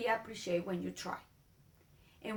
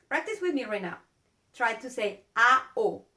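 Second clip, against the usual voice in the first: ordinary talk, nothing marked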